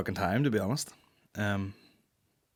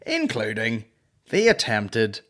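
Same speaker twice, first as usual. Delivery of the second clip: reading out the news; 4 dB louder, slower than usual. Real, not acted. Reading the headline to viewers, slow and stunned. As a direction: read the sentence out slowly, stunned. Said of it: "listen to this sentence".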